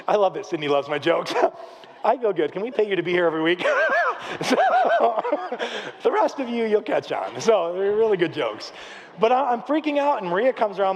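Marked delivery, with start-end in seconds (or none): 3.7-4.4 high burst of laughter. Real, not acted. Laughing at his own joke. 4.6-6.0 high, drawn-out laugh. Real, not acted. Laughing at his own joke.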